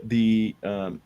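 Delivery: ordinary talk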